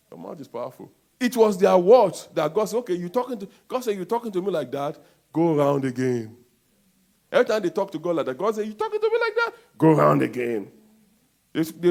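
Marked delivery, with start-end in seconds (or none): 1.2-6.4 loud preaching, voicing God; full-force sermon delivery. 8.7-10.7 God scolding his grumbling people; mock-indignant God. God voiced scolding Israelites.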